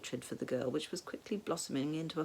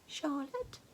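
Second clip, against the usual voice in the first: puzzled question; 11 semitones higher, 4 dB quieter than usual. A narrator's reading. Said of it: "clearly having trouble recalling".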